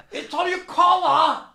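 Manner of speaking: high, slow laughing tease; teasing heckler. Retold or imitated. Heckler teasing about the polish, slow laughing tease. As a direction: giggle it out slowly. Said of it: "the fellow would go".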